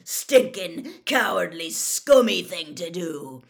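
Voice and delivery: loud, contemptuous growled rebuke; angry growl. A narrator's reading. Moody berates the ferret he is bouncing, angry growl. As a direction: spit out each insult with force.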